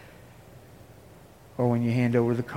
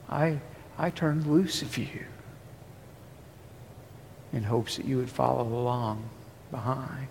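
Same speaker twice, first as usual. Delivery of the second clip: softer voice of God, trailing away; quoting God quietly; 7 dB quieter than usual. Acted. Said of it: "And now he says".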